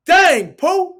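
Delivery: high, loud shout of surprise; excited call. Retold or imitated. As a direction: call his name in amazement. LeBron calling out after charge.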